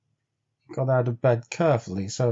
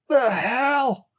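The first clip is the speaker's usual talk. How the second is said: much higher, outraged cry; cry of wrath; 13 semitones higher than usual. A narrator's reading. Henry hearing a dog is gone, crying out in wrath. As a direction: explode at the news. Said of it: "Henry cried in wrath".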